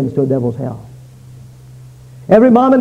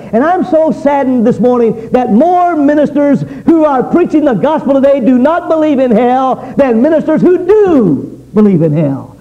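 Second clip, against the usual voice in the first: ordinary talk, nothing marked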